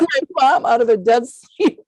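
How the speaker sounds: high, loud, emotional telling; choked up. Real, not acted. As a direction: push the words out through welling emotion. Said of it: "get choked up saying this".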